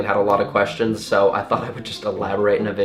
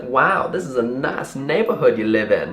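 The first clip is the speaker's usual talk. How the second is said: put-on Southern lady accent. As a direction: imitate a chatty Southern woman. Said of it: "Is that a Southern accent?".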